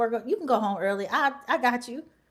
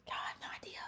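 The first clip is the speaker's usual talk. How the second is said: secretive whisper of relief; 5 dB quieter than usual. Real, not acted. Admitting to viewers she's glad he's gone, whispered. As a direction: breathe it out under your breath. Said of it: "whispering her relief".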